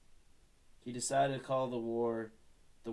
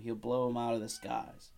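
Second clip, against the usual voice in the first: plain reading voice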